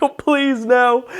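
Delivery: high, slow mock begging; pleading dread. Repeats his dreading plea, laughing.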